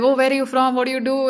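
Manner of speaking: chatty taxi driver. Retold or imitated. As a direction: imitate an eager, curious driver. Imitates chatty taxi driver's questions.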